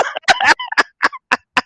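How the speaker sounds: high, long laughing cackle. Real, not acted. Friend finishing the punch story, high cackle.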